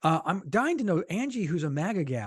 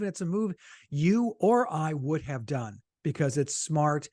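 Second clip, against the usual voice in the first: ordinary delivery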